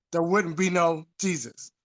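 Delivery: usual speaking voice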